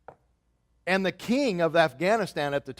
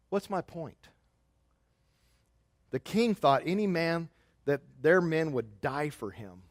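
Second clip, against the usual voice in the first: ordinary talk, nothing marked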